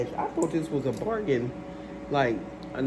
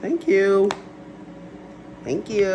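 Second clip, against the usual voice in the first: sarcastic thanks; 6 semitones higher, 7 dB louder, slower than usual. Acted.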